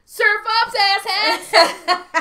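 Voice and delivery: loud, high mock holler; gleeful imitation of a catchphrase yell; Joe's imitated shout. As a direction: burst in hollering, tickled by it. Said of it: "Joe comes out".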